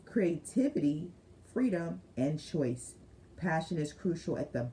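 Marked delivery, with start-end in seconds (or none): none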